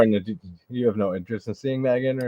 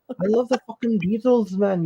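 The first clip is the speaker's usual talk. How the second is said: higher Liverpool Scouse accent; 11 semitones higher, 3 dB louder than usual. Shown as a demonstration. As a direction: spit the words like a Scouser. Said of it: "that was perfect, mate".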